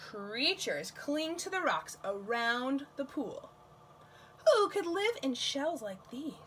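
put-on gentle storybook reading for kids; reading to children. Librarian reading a tide pool book to children, gentle storytime voice. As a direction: read the book aloud to little ones.

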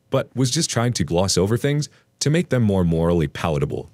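ordinary delivery

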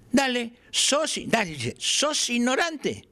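high, slow mock half-shout of the teacher; imitating the master. Teacher pushing the apprentice to admit it, high slow imitation. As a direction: prod the pupil in the old master's voice. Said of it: "me dice con voz así medio gritando".

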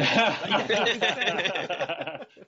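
loud high laugh; high burst of laughter. Real, not acted. Big laugh.

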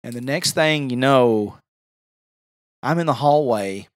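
ordinary talk

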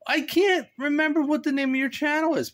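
ordinary talk, nothing marked

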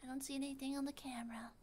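fast whisper. Real, not acted. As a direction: breathe it fast and unvoiced. Whispering while searching.

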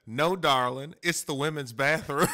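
sweetly condescending. Retold or imitated. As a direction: call him darling, patronizing. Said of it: "she said".

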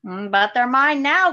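a loud, lightly growled Yeti line; mild growl. A narrator's reading. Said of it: "growled the Yeti".

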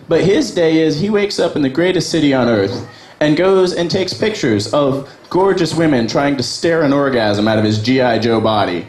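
ordinary talk

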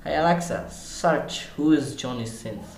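ordinary delivery

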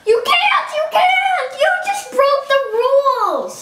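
high protesting shout. Real, not acted. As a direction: shout out the rule. Friend broke the game rules.

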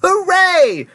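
high, loud cheer; jubilant cry. A narrator's reading. Wonka celebrating the boy's return, crying out. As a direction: cheer it out in triumph. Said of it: "cried Mister Wonka".

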